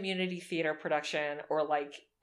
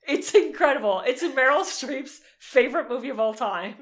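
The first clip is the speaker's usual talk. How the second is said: excited; 5 semitones higher, 8 dB louder than usual. Real, not acted. Raving about a film.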